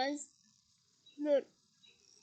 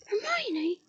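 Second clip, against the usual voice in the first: stunned and impressed; 6 semitones higher than usual. A narrator's reading. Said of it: "sounding both stunned and impressed".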